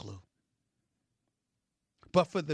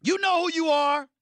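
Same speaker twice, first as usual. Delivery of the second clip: high, loud accusation; shouted call-out; 12 semitones higher, 8 dB louder than usual. Real, not acted. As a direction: call them out at the top of your voice. Calling out unnamed rivals.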